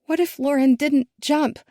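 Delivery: high, halting, horrified questions; forcing out a terrible thought; choking on the words. A narrator's reading. Daughter voiced by the narrator, choking on the words to her father. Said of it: "she chokes on the words".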